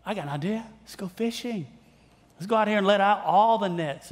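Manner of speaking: Jesus playfully urging a tired fisherman out again; cheerful suggestion. Retold or imitated. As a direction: sell the idea like a fun outing. Pastor voices Jesus' cheerful suggestion.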